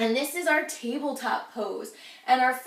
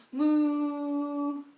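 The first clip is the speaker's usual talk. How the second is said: lowing moo like a cow; playful cow sound. Shown as a demonstration. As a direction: moo for the kids. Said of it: "And cows say".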